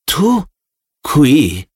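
high-pitched, halting disbelief on each word; stunned question, each word set apart; stunned surprise. A narrator's reading. Winston sees Parsons enter his cell, stunned. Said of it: "fu così sbalordito".

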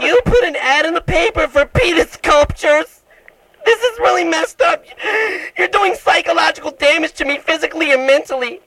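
mock outrage in a very high, tearful cry; hammed-up hysterics. Prank caller accuses a baffled tradesman over his ad, hammed-up wailing.

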